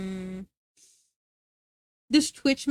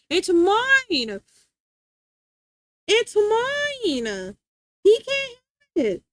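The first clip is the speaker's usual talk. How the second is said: higher, louder possessive cry; raised, high mock-possessive shout; 7 semitones higher, 4 dB louder, much slower than usual. Real, not acted. Claiming plushie from the dog.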